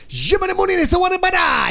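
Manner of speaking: high mock-Italian gibberish; fake Italian accent. Shown as a demonstration. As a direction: throw syllables together in a fake Italian accent. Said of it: "the fake Italian accent".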